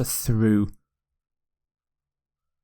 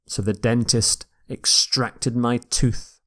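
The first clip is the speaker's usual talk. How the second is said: ordinary talk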